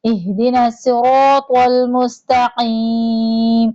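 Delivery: slow, drawn-out melodic tajweed recitation; Quran recitation. Shown as a demonstration. Teacher reciting Surah Fatiha for students to follow, slow melodic chant. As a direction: chant each verse slowly with long held vowels.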